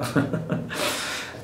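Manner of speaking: quiet, breathy laugh; soft chuckle. Real, not acted. Priest laughing after his joke's punchline, soft chuckle. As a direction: chuckle softly to yourself.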